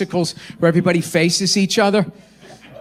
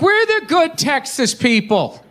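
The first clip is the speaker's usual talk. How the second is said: mock self-satisfied liberal, a bit higher; smug liberal Texan; 7 semitones higher than usual. Comic to the Texas crowd, mocking smug liberals. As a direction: sell the smug good-guy Texan. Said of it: "You're like".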